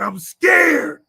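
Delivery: a man blurting out his fear, loud and high; retold scared cry. Friend just out of prison admits fear, loud scared cry. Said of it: "And he said".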